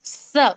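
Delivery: स as th in "think"; single lisped स, tongue slightly out. Shown as a demonstration. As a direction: say स with the tongue tip touching the upper teeth. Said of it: "जुबान की नोक आप थोड़ा सा बाहर निकालेंगे".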